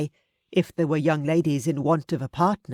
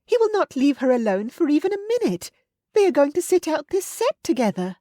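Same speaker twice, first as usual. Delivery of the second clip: put-on much higher, louder scandalized young voice; gossiping girl; 10 semitones higher, 4 dB louder than usual. A narrator's reading. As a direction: gasp it out as a thrilled gossip. Sarah Long chatters on.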